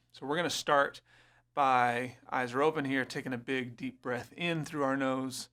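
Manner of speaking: soothing guide through breath and eyes closing; guided meditation. Acted. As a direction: ease them into stillness.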